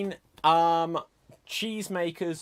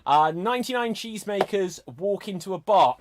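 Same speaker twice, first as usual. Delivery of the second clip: normal talking voice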